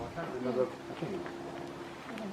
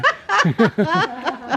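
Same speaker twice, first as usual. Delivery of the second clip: burst of laughter; 11 semitones higher, 5 dB louder than usual. Real, not acted. Laughs at Smiddle Street.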